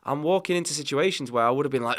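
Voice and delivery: usual speaking voice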